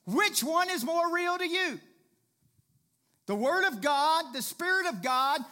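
full-force preaching. Acted. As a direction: build to the climax.

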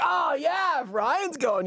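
high, exaggerated chiding; mocking crowd of friends. Imitates everybody teasing him.

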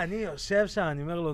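usual speaking voice

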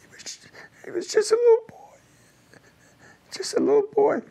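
real tears, voice cracking high; choking up on the words; voice breaking. Real, not acted. Veteran remembering the dying kid, voice breaking. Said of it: "Excuse me if I get a little emotional".

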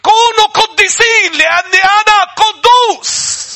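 high, loud, slow preaching shout; a preacher shouting holiness at the church; full-force preaching. Real, not acted. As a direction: thunder it from the pulpit.

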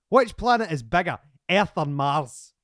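loud, slow game-show question; quizmaster. Pretend. Host giving guest question number two, quizmaster delivery. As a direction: announce the question with weighty slowness. Poses second quiz question.

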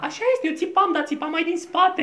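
a squeaky Eve pleading; Eva voice. Acted. Eva explaining she shouted from the back, high voice.

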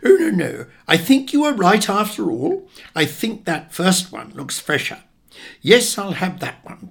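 high, loud fluster; change your mind in a flutter; fussy dithering. Acted. Dithering mother changes her mind.

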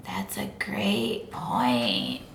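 low, slow whisper. Acted. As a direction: slowly whisper it. Evil snake whispering.

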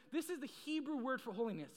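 ordinary talk, nothing marked

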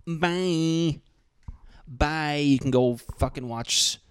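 high, drawn-out mock goodbye; mock dismissive. Mock dismissal of viewers who don't follow.

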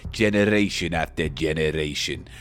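low, solemn hammy vampire; Dracula. Pretend. Vampire telling dearest Mina his origins, low and solemn. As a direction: woo her with grave accented melodrama. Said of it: "I'll be Dracula coming in".

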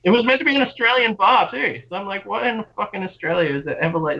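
high-pitched, exasperated ranting; worked-up bafflement at the absurdity; indignant disbelief. Real, not acted. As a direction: protest it high, as if it makes no sense. Indignant rant about the bar.

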